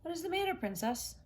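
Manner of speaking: high squeaky little frog. Acted. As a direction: pitch up for the curious frog. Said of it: "someone said".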